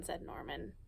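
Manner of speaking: usual speaking voice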